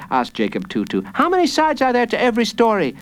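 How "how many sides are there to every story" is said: put-on high, quick little boy; child's voice. A narrator's reading. Jacob quizzes the villain, high quick child voice. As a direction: pipe it up high and fast.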